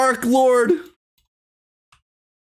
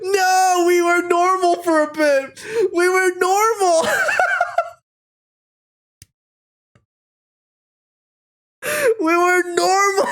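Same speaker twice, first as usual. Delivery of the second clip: higher, slowed, laughing through the words; laughing protest; 8 semitones higher, slower than usual. Real, not acted. Insisting to viewers the story was normal, laughing. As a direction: plead while cracking up.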